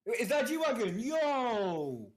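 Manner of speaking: excited. Real, not acted. Spots the car on screen.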